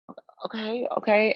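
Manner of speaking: small high stammer, re-enacting bewilderment; nervous compliance. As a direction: act out an uneasy, unsure okay. Nervously complying after being hit.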